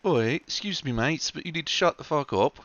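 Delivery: a playful Aussie Oi, mates; Australian accent. Pretend. Mocking how he'd tell the lawyer off, light Australian accent. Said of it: "is that an Australian accent".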